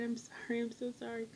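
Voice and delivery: high, pleading mock sob of an apology; imitating tearful apology. Patient apologizes to her surgeon, mock tearful and high. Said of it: "I woke up and I was crying".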